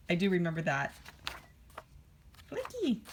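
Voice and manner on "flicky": high-pitched little creature squeak; Flicky voice. Acted. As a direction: chirp it out like a tiny creature saying its name. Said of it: "that's how they would sound".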